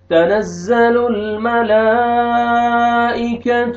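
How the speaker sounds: slow, high, melodic tilawat; Quran recitation. Shown as a demonstration. Teacher reciting the surah for students, slow melodic tilawat. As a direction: draw out every vowel in a chanting line.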